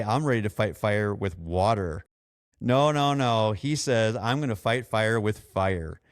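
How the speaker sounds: ordinary talk, nothing marked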